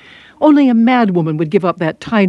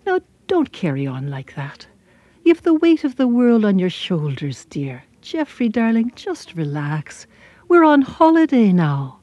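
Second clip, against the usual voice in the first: gentle Irish mother; 2 semitones higher than usual. Acted. Mother calms her serious young son, gentle Irish voice. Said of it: "said to him in the nicest way".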